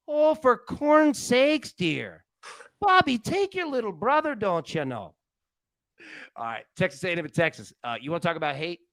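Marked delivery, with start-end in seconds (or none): 0.1-5.1 slow, high Midwestern cartoon mom voice; drawn-out Midwest mom scolding Bobby; Bobby's World mom. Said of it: "The mom from Bobby's World was the best".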